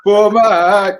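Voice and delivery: loud, high vendor's call; mock street seller. Joining the joke about selling the magazine, loud high street cry.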